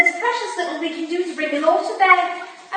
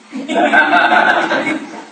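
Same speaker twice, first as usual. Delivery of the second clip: light laugh; 12 semitones lower, 5 dB louder than usual. Acted. Amused at brandy request.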